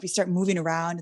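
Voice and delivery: normal talking voice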